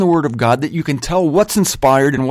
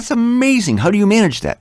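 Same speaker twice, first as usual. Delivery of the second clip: much higher, feigned wonder; mock amazement; 6 semitones higher than usual. Preacher retelling his mock-amazed reply to the churchgoer, higher. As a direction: act impressed, tongue in cheek.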